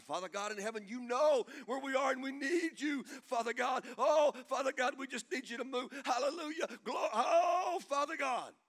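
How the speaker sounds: high, loud hammed-up praying; mock prayer voice. Preacher imitating church pray-ers, loud mock prayer voice. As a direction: build into an overblown revival prayer. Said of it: "then we have a prayer voice".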